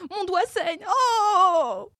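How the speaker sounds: shrill royal crying out. A narrator's reading. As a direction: wail over a bleeding finger. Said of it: "criait la souveraine".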